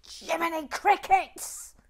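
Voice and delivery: hammed-up gasp of dismay; mock exclamation. Real, not acted. Exclaims at mistake.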